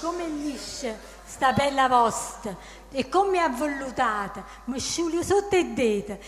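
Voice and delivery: reciting verse in Neapolitan dialect; Neapolitan dialect poem. Acted. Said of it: "Dillo, dai, alza così".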